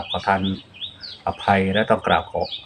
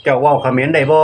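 Isan dialect demo; 4 semitones higher, 4 dB louder than usual. Shown as a demonstration. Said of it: "คุณพูดเขมรได้ไหม อันนี้นะครับ".

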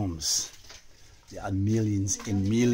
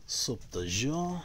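ordinary delivery

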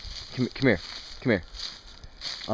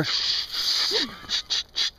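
shushing; 11 semitones higher, 4 dB quieter, slower than usual. Real, not acted. Hushing partner near stump.

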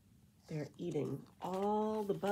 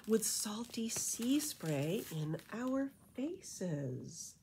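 lilting rhymed storybook read; reading to children; 5 dB louder, slower than usual. A narrator's reading. Reading a bat beach story to kids, lilting rhyme. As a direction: read it like a bedtime picture book. Reading rhyming picture book aloud.

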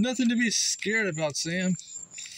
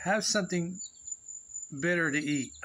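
usual speaking voice

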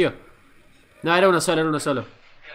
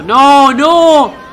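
shocked cry; 8 semitones higher, 12 dB louder than usual. Real, not acted. Shocked at a fall in clip.

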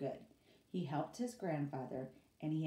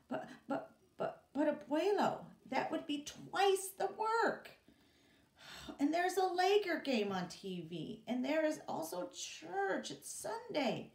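much higher, pleading stammer; pleading whine; 11 semitones higher, 4 dB louder than usual. Acted. Boy begging grandfather, high pleading whine. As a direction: beg to get out of it.

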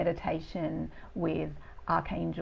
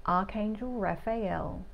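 lingering, soothing meditation delivery; guided meditation; 5 dB quieter than usual. Real, not acted. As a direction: guide listeners gently into their bodies, unhurried.

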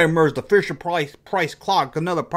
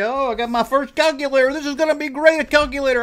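put-on much higher, gushing kid thrilled with a new toy; mock childish excitement; 10 semitones higher than usual. Recalling unwrapping the calculator as a kid, higher gushing excitement. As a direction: play the kid who just unwrapped a calculator. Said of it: "You think when you get it".

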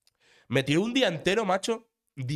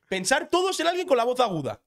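excited appeal; 9 semitones higher, 5 dB louder than usual. Real, not acted. Mock appeal to listeners.

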